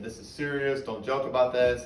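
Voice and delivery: ordinary talk, nothing marked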